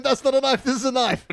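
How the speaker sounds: quoted movie hero line. Retold or imitated. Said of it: "someone can say to him".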